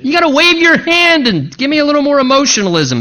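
mock-theatrical demand; mocking demanding Naaman. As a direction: ham up a proud man wanting drama. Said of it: "he says".

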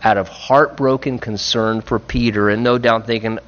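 ordinary delivery

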